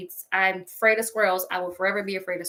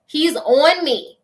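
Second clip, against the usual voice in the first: high, loud and slow panicked cry; mock-terrified cry of a squirrel on her; 7 semitones higher, 8 dB louder, much slower than usual. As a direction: relive the terror, drawn out. Panic reliving the attack.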